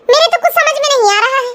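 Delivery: normal talking voice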